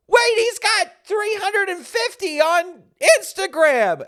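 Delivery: high, loud imitation of freaking out; excited screaming. Girl discovering the puppet's Instagram following, screaming excitedly. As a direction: shriek it like an amazed fan. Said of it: "They're screaming".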